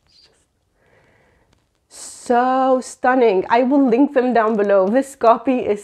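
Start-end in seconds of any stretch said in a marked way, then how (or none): 0.1-3.4 very slow, stretched out stunning; lingering admiration; drawn-out awe. Real, not acted. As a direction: savour every word.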